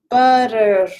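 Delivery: Butter with a quick tongue-tap middle, said loud; flapped butter. Shown as a demonstration. Teacher demonstrating Butter with a tongue flap. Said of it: "can you say along a butter".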